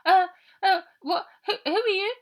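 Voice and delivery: high, loud stammering fright; startled girl. A narrator's reading. Trapped girl startled by a stranger, stammering high. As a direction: stammer out the question in alarm.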